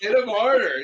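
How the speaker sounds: put-on high, loud echo of urging kids; mock-kid cheer. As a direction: yell it like an excited kid, in fun. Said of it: "and the kids going".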